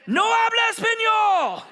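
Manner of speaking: high, loud shout in clumsy Spanish; hammed-up yelled Spanish; mock shout. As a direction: shout it back, playing the clueless foreigner. Feigned ignorance shouted back at cop.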